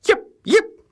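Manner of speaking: very high, loud yips; tiny dog yip. A narrator's reading. Reader yips again for Schnitzel, very high. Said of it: "said Schnitzel von Krumm".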